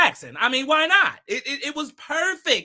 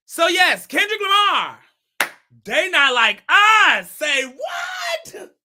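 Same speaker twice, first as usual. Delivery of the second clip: loud, high announcer call; high-pitched hollering, words stretched out; hyped shout; 6 semitones higher, 6 dB louder, slower than usual. Pretend. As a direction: shout it out with big hype.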